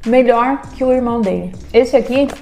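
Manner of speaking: tasting food while speaking; chewing while talking. Real, not acted. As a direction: mouth partly full of sweet. Seen 0: Holds bitten sweet ball, mouth working as if chewing while talking.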